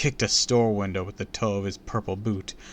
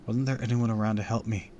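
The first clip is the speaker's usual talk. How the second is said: ordinary delivery, nothing marked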